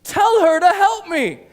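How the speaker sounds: high, loud, stretched-out order; exasperated demand. Acted. Martha ordering Jesus about her sister, frustrated shout. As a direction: burst out, at the end of your patience. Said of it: "this is how crazy she's getting".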